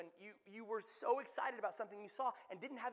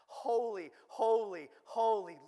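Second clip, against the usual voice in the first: each word loud, falling in tone; heavenly proclamation; 10 dB louder, slower than usual. Acted. Preacher voicing the four creatures' unending praise, loud proclamation. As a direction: proclaim it loudly, word by separate word. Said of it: "four creatures in verse eight never stop saying".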